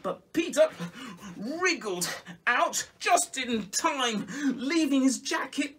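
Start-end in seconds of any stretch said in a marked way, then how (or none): none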